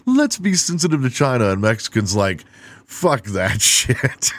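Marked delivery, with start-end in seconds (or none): 0.1-1.5 high, prissy mock plea; lightly mocking the world being sensitive; mock prim high voice. Comedian mocks the world's sensitivity, in a prim high voice. 2.9-4.3 slow, laughing dismissive drawl; mock defiant reply. Comedian voices Mexicans' reply, a slow laughing shrug.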